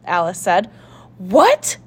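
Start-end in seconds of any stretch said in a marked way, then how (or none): 1.2-1.8 louder, higher shocked outcry; startled cry; cried out. A narrator's reading. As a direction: blurt it out in disbelief. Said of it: "cried Charlie".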